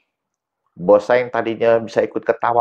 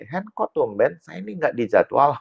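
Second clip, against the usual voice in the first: imitated higher-pitched, puzzled questioning; puzzled caller; 7 semitones higher than usual. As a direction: voice a caller wondering why he was left out. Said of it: "dia telepon saya".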